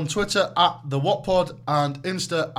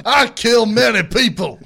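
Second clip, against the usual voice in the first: mock gangster boast; 5 semitones higher, 7 dB louder than usual. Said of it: "mafia boss".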